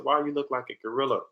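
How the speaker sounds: put-on high, light little girl voice; young girl's voice. Teacher imitating a young student, high and blunt. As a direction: mimic a nine-year-old blurting it out. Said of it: "she said".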